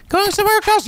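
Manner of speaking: very high falsetto question; girl character voice. Acted. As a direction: ask it as a little girl in falsetto.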